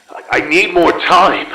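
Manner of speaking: sudden desperate outburst; desperate plea. Acted. Desperate as time runs out.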